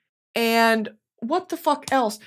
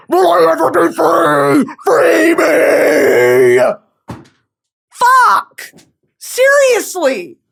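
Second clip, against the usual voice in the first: hammed-up crying shout, much higher and louder; mock anguished wail; 7 semitones higher, 6 dB louder, much slower than usual. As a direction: wail to the heavens in overdone despair. Dramatic anguish over ex's dream.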